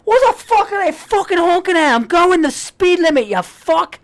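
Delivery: high, yelled Australian road rage; angry Australian. Retold or imitated. Dad yelling at honking cars, mock Australian fury. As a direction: imitate a furious Australian driver shouting at the honkers. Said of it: "he's Australian. He's like".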